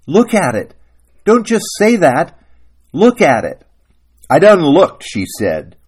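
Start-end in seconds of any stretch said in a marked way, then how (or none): 0.1-3.7 loud, high, urgent acted plea; forceful raised-voice demand; crying out. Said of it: "He wheeled around and cried". 4.3-5.0 loud, high retort; raised-voice acted comeback; quarrelling wife. Wife snaps back in quarrel.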